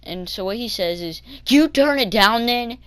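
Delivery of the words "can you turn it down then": louder, higher imitated rudeness; rude. Bus driver telling kid about the music, imitated rudeness. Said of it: "being all rude and stuff".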